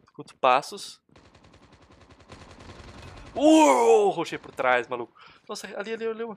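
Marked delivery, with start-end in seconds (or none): 3.3-4.1 loud, high, drawn-out "uou"; long falling cry; startled whoop. Real, not acted. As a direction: whoop it out in surprise. Caught from behind.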